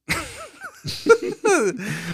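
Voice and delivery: real uncontrollable laughter; howling laughter. Real, not acted. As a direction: double over laughing. Laughter continues.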